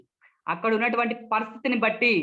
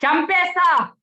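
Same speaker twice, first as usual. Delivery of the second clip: high, loud, furious threat; angry; 9 semitones higher, 8 dB louder, slower than usual. Shown as a demonstration. Speech trainer demonstrates anger with a threat, loud and furious. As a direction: explode with anger. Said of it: "కోపం".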